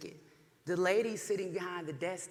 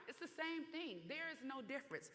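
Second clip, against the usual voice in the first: quiet; 5 semitones higher, 15 dB quieter than usual. Real, not acted. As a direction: drop to a hush to land it. Pointed aside after loud run.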